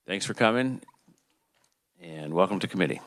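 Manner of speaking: normal talking voice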